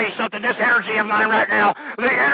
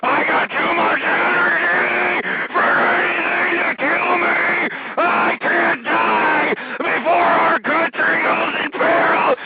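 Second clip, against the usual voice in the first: passionate shout; 3 semitones higher, slower than usual. Acted. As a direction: scream it with tearful passion. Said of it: "The passion".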